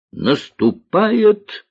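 drawn-out, high exclamation; commander's cry. A narrator's reading. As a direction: cry it out slowly, stretching the word. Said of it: "выкрикнул он". (протяжный командирский выкрик)

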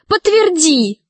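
urgent plea; pleading. A narrator's reading. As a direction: beg him to back you up. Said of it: "взмолилась Алиса".

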